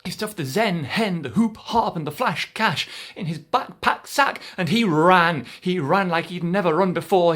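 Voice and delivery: excited chase telling; breathless chase narration. A narrator's reading. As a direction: race through the escape. Frantic chase.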